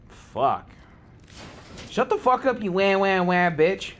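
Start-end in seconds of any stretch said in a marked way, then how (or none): 1.9-3.9 high, jeering wah-wah; mock whining. Gamer mocking a complaining player, whiny jeer.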